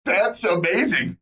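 squealing, high and loud excitement; freaking out. Real, not acted. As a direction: gush over it at full volume. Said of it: "me freaking out about how amazing".

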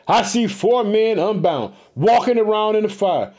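usual speaking voice

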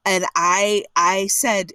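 ordinary talk, nothing marked

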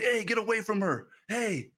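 a retold warning yell, not full force; retold shout. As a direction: call out "get away" as a stranger would. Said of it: "started shouting".

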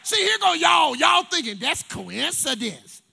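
loud, slow teasing jab; playful loud tease. Real, not acted. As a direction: challenge the doubters out loud. Teasing the doubting crowd.

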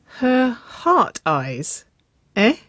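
high, very slow knowing tease; amused teasing. A narrator's reading. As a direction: draw it out to tease them. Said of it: "Gus chuckled".

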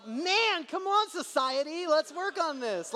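high, slow exasperated plea; drawn-out mock despair; frustrated. Real, not acted. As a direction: throw up your hands at society. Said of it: "I was so frustrated".

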